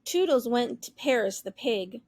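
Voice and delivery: usual speaking voice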